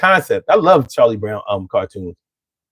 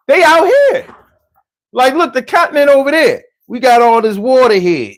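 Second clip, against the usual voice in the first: playful mock; 8 semitones higher, 4 dB louder than usual. Real, not acted. Mocking island off continent.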